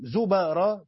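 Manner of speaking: زبرا said "zubara"; a after the ب in زبرا; fatha on the ب. Shown as a demonstration. Teacher recites the verse in this reading, fatha on the ب in زبرا. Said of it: "بفتح الباء".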